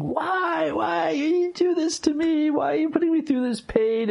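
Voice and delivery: reliving the despairing plea to heaven; retold anguished cry. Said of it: "I'm screaming at God".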